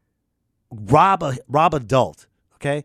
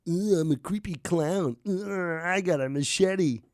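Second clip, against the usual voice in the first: creepy clown; 2 semitones higher, 3 dB quieter than usual. Pretend. Comic imitates creepy clown.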